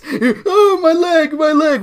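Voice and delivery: hammed-up wail about her leg; whiny mock wail. Mocking Dance's complaint.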